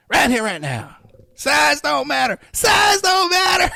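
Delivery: high, loud, slow shouted joke; hammed-up drunk girlfriend; mock girlfriend shout. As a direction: belt her lines in a high mock voice. Repeats girlfriend's drunk lines.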